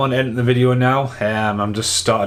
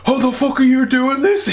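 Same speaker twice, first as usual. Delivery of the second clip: much higher, stunned mock voice; Carl's shocked disbelief; 13 semitones higher, 3 dB louder than usual. A guy imitating his friend's disbelief at guessed movie answers, high and stunned. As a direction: squeak it out in disbelief. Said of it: "He was like".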